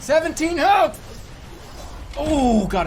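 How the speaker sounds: loud high cry of hype; excited shout. Real, not acted. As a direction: yell the number in disbelief. Watching boss fight, tense.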